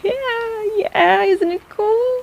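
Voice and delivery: high, slow, thrilled squeal; can't contain the excitement; gushing. Acted. Healer gushing to patient about the angel's glow.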